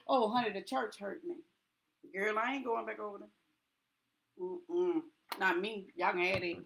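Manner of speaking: low, soft mocking voice of a hurt member; mock church gossip. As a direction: play the offended churchgoer, dismissive. Mocking church members who left.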